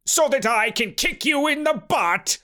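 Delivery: high-pitched cross uncle; indignant cry. A narrator's reading. Uncle threatens his nephew from crystal prison, indignant cry. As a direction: threaten like an irate old relative. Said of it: "Uncle Horbert cried".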